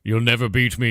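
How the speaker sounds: lightly voiced gloating death knight; mock Arthas villain. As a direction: taunt the heroes like a cartoon villain. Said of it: "and he's like".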